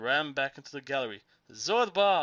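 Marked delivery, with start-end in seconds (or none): 1.5-2.2 loud, high cry of his name; shout. A narrator's reading. Narrator calling Zorba in the gallery, loud shout. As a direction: call him desperately into the dark. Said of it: "I shouted".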